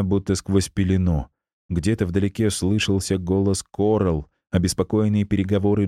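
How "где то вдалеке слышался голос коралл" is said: usual speaking voice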